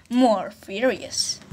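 ordinary talk, nothing marked

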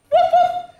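high, loud playful woof; puppy bark. Acted. Teacher showing kids the puppy bark, high and loud. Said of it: "And what does he say?".

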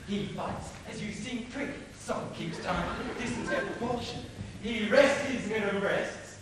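normal talking voice

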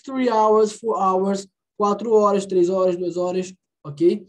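ordinary talk, nothing marked

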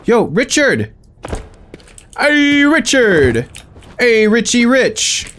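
high, drawn-out call across the room; hollering for a buddy. Acted. Calling out to Richard.

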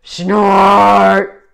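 huge, rough, roaring "snort"; very loud growled storybook noise; machine snort. Acted. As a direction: roar the word like a big machine. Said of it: "big thing just said".

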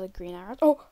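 usual speaking voice

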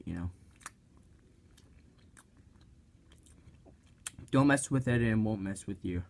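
chewing while talking; talking between bites; mouth full of wrap. Real, not acted. Seen 3: Bites and chews the wrap throughout the labelled words.

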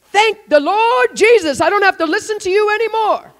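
loud, high cry of relief; exasperated relief. Retold or imitated. Partner freed from years of complaints, loud relieved cry.

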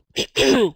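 real throat clear from illness. Real, not acted. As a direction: clear the throat before going on. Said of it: "Scusate questa tosse".

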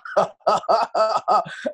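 a short, real burst of laughter; hearty laugh. Real, not acted. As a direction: laugh it out freely. Laughter after the line.

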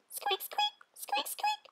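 very high soft mouse squeaks; tiny squeaks. A narrator's reading. Baby mice squeaking beside the ruined house. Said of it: "They were all squeaking".

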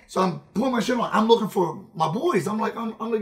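ordinary talk

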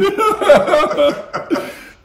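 loud high burst of laughter; real laughter. Real, not acted. Laughing with a friend at the memory, real loud laugh. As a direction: crack up at your own story.

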